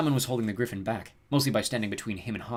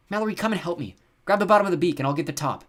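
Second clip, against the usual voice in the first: higher, raised instructions; pressing, louder voice; calling for help; 9 semitones higher, 7 dB louder than usual. A narrator's reading. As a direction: call a helper over urgently. Simon calls sister to help.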